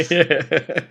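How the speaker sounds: laughing loudly. Real, not acted. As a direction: burst out laughing. Laughing at the joke.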